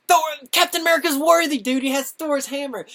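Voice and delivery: high, loud and fast fan excitement; excited. Real, not acted. Fan gushing about Captain America lifting the hammer, loud and high. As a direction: gush it out, thrilled.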